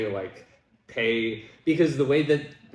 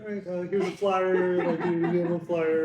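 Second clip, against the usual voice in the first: meek flyer handout; 6 semitones higher than usual. Retold or imitated. Toned-down barking after being scolded.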